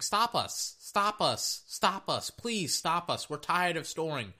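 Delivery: high mock pleading, stop us; high mock please; taunting offense. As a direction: taunt the defense in a high begging voice. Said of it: "they just keep saying".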